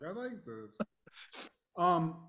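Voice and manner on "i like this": softly savoring it. Retold or imitated. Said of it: "I was like".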